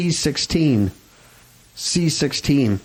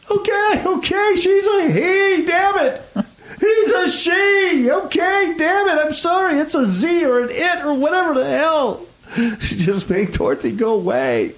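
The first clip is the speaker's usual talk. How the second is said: mock breakdown. Host playing a prisoner forced to watch Wizard of Oz, hammed-up breakdown. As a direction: crack under pretend torture, pleading in a high voice. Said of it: "till you break".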